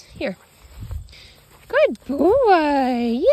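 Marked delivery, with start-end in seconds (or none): 1.7-3.2 louder, drawn-out praise; loud dog praise. Real, not acted. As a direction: reward him warmly and loud.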